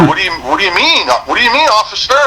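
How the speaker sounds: high, incredulous yell; mock yelling at the officer; yelling back. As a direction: shout your confusion back across the lanes. Retold yelling back at cop.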